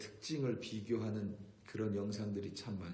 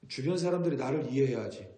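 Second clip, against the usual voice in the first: louder, higher stubborn "I'm just like this"; stubborn self-justifying claim, played louder; mock self-centred insistence; 3 semitones higher, 7 dB louder than usual. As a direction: insist like a selfish person, a bit hammed up. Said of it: "라고 말하는 것도 자기중심적인".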